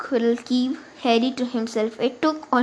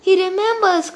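higher, louder delighted cry; excited cry; 7 semitones higher, 5 dB louder than usual. A narrator's reading. Tiggle thrilled that Harry remembers him, crying out. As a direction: cry it out to the whole room. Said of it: "cried Deadless Tiggle".

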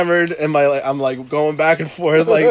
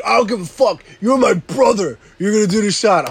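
higher, louder bossy Dave voice; pushy friend; 6 semitones higher, 4 dB louder than usual. Retold or imitated. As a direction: order him to drink. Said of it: "He's like".

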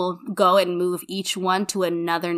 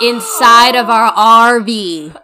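slow, louder, drawn-out announcement; dramatic reveal; 3 semitones higher, 11 dB louder, much slower than usual. Retold or imitated. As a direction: spell out the shock to her friend. Reveals mice in the RV.

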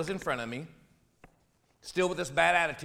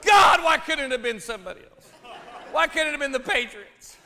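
laughing lament; 10 semitones higher, 4 dB louder than usual. Real, not acted. Comic lament, laughing shout.

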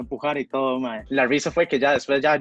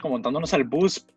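ordinary talk, nothing marked

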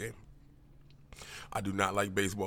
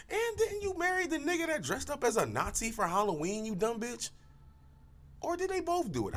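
high mock berating. Berating Meghan Markle.

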